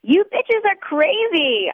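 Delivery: put-on high, slow drunken wail up at the ceiling; drunken cry. A narrator's reading. Drunk woman crying up at the tin ceiling, slow high wail. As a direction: howl it out, sloppy and slow. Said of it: "I cry to the tin ceiling".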